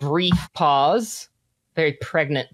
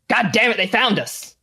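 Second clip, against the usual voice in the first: higher, urgent cursing; alarmed man; 7 semitones higher than usual. Acted. Game master as a man who's been found, alarmed outburst. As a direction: curse in alarm at being discovered. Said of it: "a grown man's voice say".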